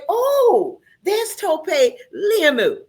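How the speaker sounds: like a fan spotting a celebrity on the street; "Oh, there's Tope Liamu" squealed high and drawn out; excited recognition. Pretend. Host voices a stranger spotting guest.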